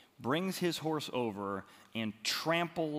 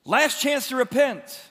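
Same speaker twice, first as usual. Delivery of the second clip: urgent appeal; 10 semitones higher, 11 dB louder than usual. Real, not acted. Preacher pressing the warning.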